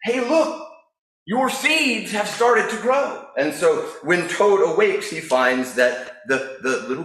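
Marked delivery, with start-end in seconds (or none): 0.0-3.3 much higher, slow cheerful wake-up; lightly voiced happy frog; Frog character. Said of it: "and he says".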